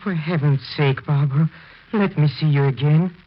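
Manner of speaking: loud, high-pitched urgent begging; desperate plea. Acted. Husband pleading to see his dead wife, loud and desperate. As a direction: plead with her to show herself.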